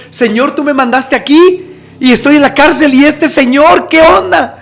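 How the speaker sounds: exasperated plea, pitched high; desperate appeal. Retold or imitated. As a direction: cry out to God in bewilderment. Retold desperate appeal to God.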